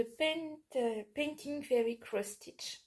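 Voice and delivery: slow, careful English-accented product name; French speaker trying an English accent; English accent attempt. Real, not acted. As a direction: nom anglais prononcé lentement avec un accent anglais appliqué. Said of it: "Wow, mon accent".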